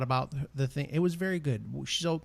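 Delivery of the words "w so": ordinary talk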